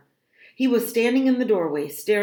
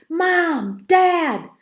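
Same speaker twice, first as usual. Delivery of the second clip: high, loud call for parents; alarmed shouts for help; calling out urgently; 11 semitones higher, 7 dB louder than usual. A narrator's reading. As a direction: yell through the house. Bursts into house calling parents.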